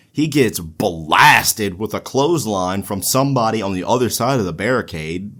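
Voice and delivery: normal talking voice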